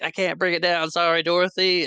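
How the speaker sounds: hammed-up high voice, helplessly sorry; mock helpless apology. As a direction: call a playful apology from a drifting balloon. Wizard's retold apology floating away.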